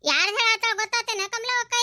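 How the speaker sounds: usual speaking voice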